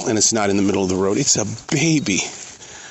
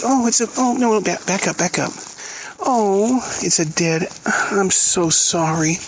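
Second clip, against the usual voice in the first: upset; 9 semitones higher than usual. Real, not acted. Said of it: "hang on, I'm having a moment".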